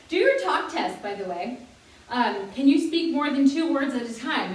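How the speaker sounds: normal talking voice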